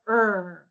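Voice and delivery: "Er" with lips rounded, tongue pulled back; R sound demo. Shown as a demonstration. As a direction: hold a buzzing "Er", tongue not touching. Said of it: "you should feel a vibration".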